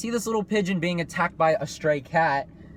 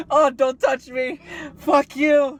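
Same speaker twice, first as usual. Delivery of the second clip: higher, slower and louder, fed up; grumpy hamster voice; 8 semitones higher, 5 dB louder, slower than usual. Pretend. The hamster on its deathbed snapping at the kid, a hammed-up grumpy voice. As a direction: play the dying pet who hates being touched.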